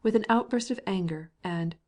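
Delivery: ordinary delivery, nothing marked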